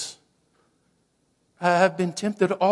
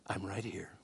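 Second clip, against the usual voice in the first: soft reassuring whisper; hushed promise of presence; tender whisper; 9 semitones lower, 16 dB quieter than usual. Acted. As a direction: whisper it close, like comfort. Jesus answering in the stable.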